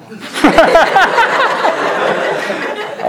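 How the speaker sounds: a loud airy burst of real laughter. Real, not acted. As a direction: crack up at the punchline. After "pray in Coptic.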